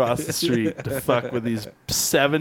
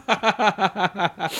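laughing; 7 semitones higher than usual. Real, not acted. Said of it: "started laughing".

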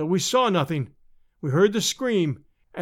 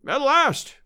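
high, exultant exclamation; triumphant cry; 8 semitones higher than usual. A narrator's reading. Climber reaching the far side at last, crying out. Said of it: "he cried".

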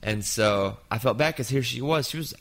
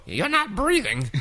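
put-on high, slow old lady scolding; slow high old lady pointing it out; old woman voice; 10 semitones higher, slower than usual. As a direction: chide him like a fussy old woman. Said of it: "that's my old woman voice".

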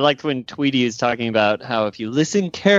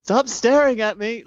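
imitating yelling director; 11 semitones higher than usual. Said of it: "Weed Minlow in the background yelling".

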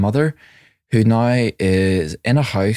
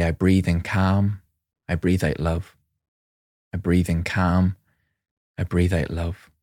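calm mantra; 3 semitones lower, 4 dB quieter than usual. Shown as a demonstration. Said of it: "I sat and I breathed in".

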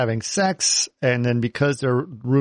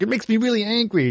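mock whiny; 10 semitones higher than usual. Retold character line, pitched up.

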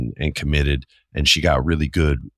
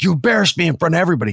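high, fast, louder outburst; embarrassed student yelling at his acting coach; furious yell; 13 semitones higher, 4 dB louder, much faster than usual. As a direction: blurt it out high and fast in outrage. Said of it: "I was like".

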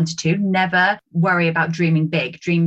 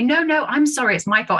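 higher-pitched, polite mock apology; imitated insistent apology; 7 semitones higher than usual. Stranger in a queue to queue-jumper, imitated insistent apology. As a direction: insist politely that you take the blame.

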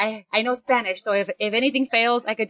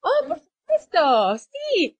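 imitating locals, much higher and slow; friendly locals; much slower than usual. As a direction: chirp it high like a relieved, happy stranger. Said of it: "And they're like".

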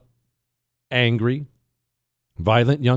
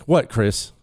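ordinary delivery

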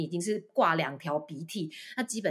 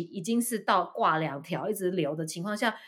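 usual speaking voice

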